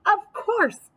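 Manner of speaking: very high, bouncy little hare. Acted. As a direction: squeak it like a perky hare. Hare's high voice.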